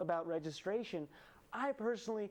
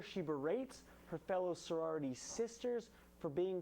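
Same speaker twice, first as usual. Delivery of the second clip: usual speaking voice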